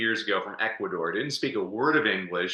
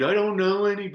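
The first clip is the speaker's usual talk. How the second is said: high whiny complaint; whining; 10 semitones higher, 3 dB louder than usual. Retold or imitated. Imitating Jason's high whine to his coach. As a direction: whine like a reluctant client. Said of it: "And Jason whined".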